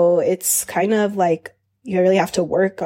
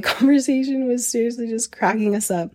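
ordinary talk, nothing marked

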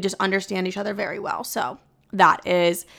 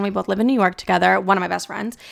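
ordinary talk

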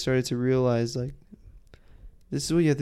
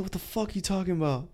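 pitched-up stunned cursing; mock-hyped what the fuck; hyped disbelief; 6 semitones higher, 3 dB quieter than usual. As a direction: blurt it out in giddy shock. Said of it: "I got hyped".

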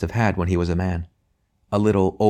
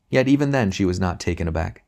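plain reading voice

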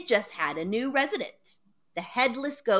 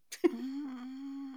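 quiet breathy laugh; a hushed little laugh; soft laugh; 4 semitones higher, 8 dB quieter than usual. Real, not acted. Laugh after the story.

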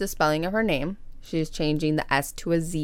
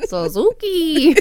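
high, slow revving motorbike voice; motorcycle voice; 11 semitones higher, 7 dB louder, slower than usual. Pretend. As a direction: stretch it out like an engine revving. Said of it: "You also sound like a motorcycle".

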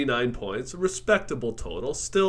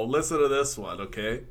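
ordinary talk

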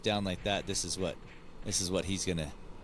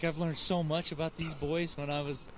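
quoting mom; 6 semitones higher than usual. Retold or imitated. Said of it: "She's like".

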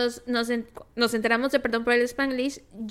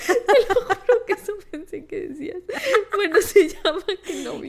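words squeezed out through laughter; laughing while talking; 9 semitones higher, 5 dB louder than usual. Real, not acted. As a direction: talk while still cracking up.

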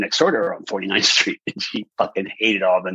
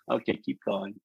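quiet, unruffled; 9 dB quieter than usual. Retold or imitated. Judge letting the contestant carry on, quiet and unruffled. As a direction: imitate an unfazed judge, much softer.

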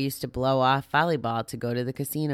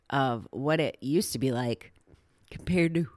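yawning. Real, not acted. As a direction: talk through a yawn. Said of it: "And I'm yawning as I'm telling you".

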